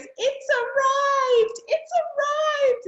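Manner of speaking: high, slow, squealing with joy; thrilled squeal. Retold or imitated. Daughter bursting in to tell mum, ecstatic squeal. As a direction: announce it like the best news ever.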